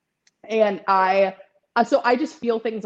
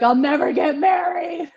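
hammed-up wail, higher and louder; mock despair; 7 semitones higher, 4 dB louder than usual. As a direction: wail it like your love life is over, overdone. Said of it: "I cry and I just eat ice cream".

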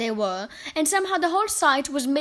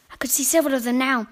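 ordinary delivery, nothing marked

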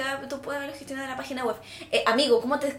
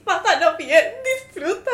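higher and louder hammed-up farewell; mock tearful goodbye; 8 semitones higher, 9 dB louder than usual. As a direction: wail a dramatic goodbye. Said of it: "y yo así".

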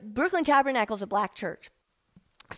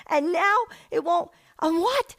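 a touch of frantic pleading; frustrated disbelief; 6 semitones higher than usual. Retold or imitated. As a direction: pour out baffled frustration. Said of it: "I'm like".

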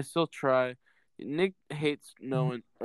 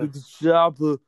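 louder mock Austrian accent; Arnold impression; 8 dB louder than usual. Host repeats the line in a loud Austrian accent. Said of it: "It's Arnold Schwarzenegger".

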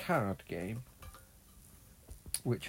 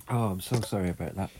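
ordinary delivery

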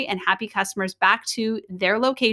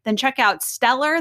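usual speaking voice

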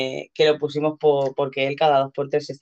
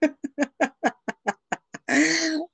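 giggling; 10 semitones higher, 4 dB quieter than usual. Real, not acted. Partner's phone just died.

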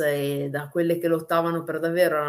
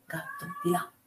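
murmured aside to the cat; softly shooing the cat away; quiet aside; 9 dB quieter than usual. Real, not acted.

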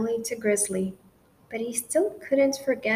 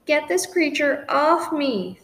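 higher, louder howl of disgust; a storybook dog's loud howl; howling protest; 5 semitones higher, 4 dB louder than usual. A narrator's reading. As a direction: cry out to get the cat off. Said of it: "Scrounger howled".

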